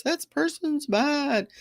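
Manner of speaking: mock judgmental, high and slow; high-horse judging. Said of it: "get all up on our high horse".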